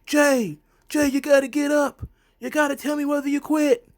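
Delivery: high, loud urgent plea to a downed wrestler; pleading referee. Retold or imitated. Referee urging the fallen Jey to answer, high and loud. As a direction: beg him to get up or quit. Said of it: "the referee is like".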